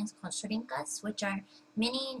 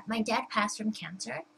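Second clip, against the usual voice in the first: normal talking voice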